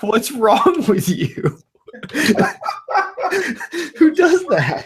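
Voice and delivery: high-pitched laughing through the words; cracking up, can barely get it out; laughing disbelief. Real, not acted. Laughing at the neighbor who napped while her dog was lost. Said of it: "laughing disbelief".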